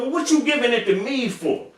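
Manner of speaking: high whiny plea; Crunchy's offended voice. Retold or imitated. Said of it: "Here you go".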